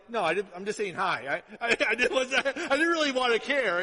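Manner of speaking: laughing through the quote; loud comic retelling with laughs; laughing retold brush-off. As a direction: imitate an awkward brush-off, chuckling.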